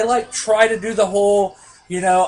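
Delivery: ordinary delivery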